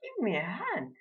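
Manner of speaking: high-pitched lady giving an order; high, commanding lady's voice; imperious lady customer. A narrator's reading. Acted lady orders the butcher.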